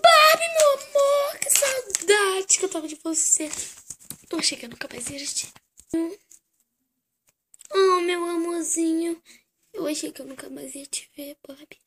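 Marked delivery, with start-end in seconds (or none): 4.3-5.5 tender whisper. Acted. Emotional reunion whisper. 9.7-11.8 tender whisper. Acted. Emotional reunion whisper.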